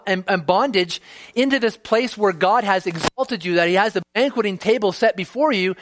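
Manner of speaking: rising, impassioned sermon voice; preaching. Acted. Preaching builds.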